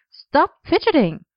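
high, sharp scolding; sharp command. A narrator's reading. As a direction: snap it out like a brisk tailor. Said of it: "with a sharp".

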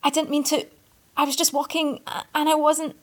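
panicked apology, stumbling; flustered stammer. A narrator's reading. The boy stammers excuses to the furious Arthur. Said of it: "the idiot stammered".

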